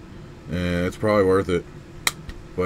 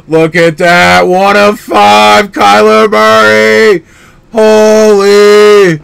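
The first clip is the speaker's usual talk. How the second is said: loud, high, drawn-out yell of disbelief; excited shout; 10 semitones higher, 11 dB louder, much slower than usual. Real, not acted. Collector yelling at his viewers over a rare pull, thrilled. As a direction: shout it out, slowly, stunned by the card. Pulls a rare card live.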